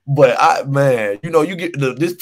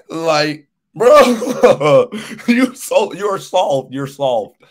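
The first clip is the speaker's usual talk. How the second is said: giggling as you tease him; laughing; 3 semitones higher, 4 dB louder, much slower than usual. Real, not acted. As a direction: laugh through the words, a bit higher. Laughing while calling player soft.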